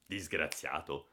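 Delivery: through gritted teeth. Acted. Brother-in-law scolding Giannino, through gritted teeth. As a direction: grind the word out, slow and low. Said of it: "esclamò a denti stretti".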